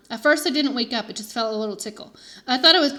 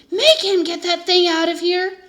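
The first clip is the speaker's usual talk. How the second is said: loud, slow, higher boom; 7 semitones higher, 7 dB louder than usual. A narrator's reading. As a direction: boom the order out. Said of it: "Mister Yarby boomed".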